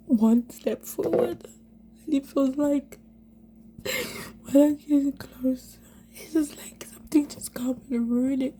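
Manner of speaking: high, strained and tearful, slowed to a crawl; fighting back sobs as the words come out; voice breaking into tears. Real, not acted. To the camera about losing her chance, close to tears. Breaking down over lost hope.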